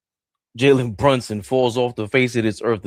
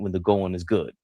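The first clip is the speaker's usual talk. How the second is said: ordinary delivery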